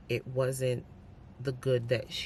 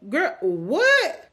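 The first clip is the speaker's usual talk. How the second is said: incredulous outburst; 12 semitones higher, 12 dB louder, slower than usual. Real, not acted. Reacting in disbelief to book character.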